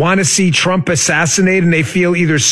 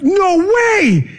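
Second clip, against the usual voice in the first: mock shocked shout; 13 semitones higher than usual. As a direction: fake stunned yell. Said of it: "I hope you sense the dripping sarcasm".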